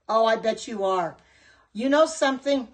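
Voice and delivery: normal talking voice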